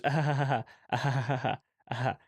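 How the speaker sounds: mock canned sitcom laughter; imitating laugh track. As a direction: do the studio audience laugh. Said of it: "the laugh track goes".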